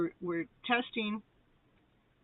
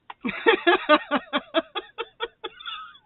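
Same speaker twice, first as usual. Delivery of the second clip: long, high peal of laughter; real laughter; 13 semitones higher, 10 dB louder than usual. Real, not acted. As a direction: laugh loud and helplessly, letting it run on. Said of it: "I was laughing at Asha".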